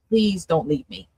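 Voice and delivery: softly pleading, moved for real; real emotion in a soft plea; getting emotional. Real, not acted. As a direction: plead quietly as emotion wells up. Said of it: "I'm getting emotional now".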